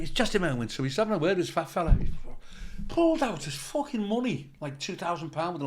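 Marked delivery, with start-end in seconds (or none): none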